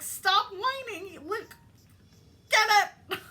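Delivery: very high, loud, slow scold with a laugh; hammed-up bossy feminine voice; mock scolding. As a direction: snap at the whiner, laughing.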